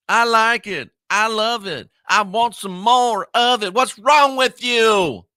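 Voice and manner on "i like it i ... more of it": a lightly voiced country singer quoting the hook. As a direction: toss off the song line in a hint of country twang. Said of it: "go [name] on that".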